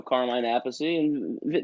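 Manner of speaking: ordinary delivery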